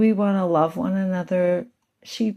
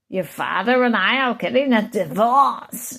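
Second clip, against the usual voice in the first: mother as Satan; 4 semitones higher, 6 dB louder than usual. Retold or imitated. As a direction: make the mother sound like Satan himself. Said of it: "Maybe that's Satan's voice".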